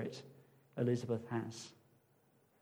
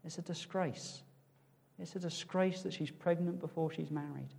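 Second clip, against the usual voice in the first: softly voiced disapproving gossip; gossiping townspeople; 8 dB quieter than usual. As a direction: pass on the scandal in a hushed tone. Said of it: "People are gonna say".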